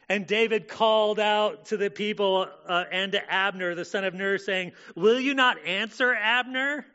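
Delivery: loud, high preaching read of the call; forceful scripture reading. Acted. Preacher reading David calling out to Abner, loud and forceful. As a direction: proclaim the passage at full force.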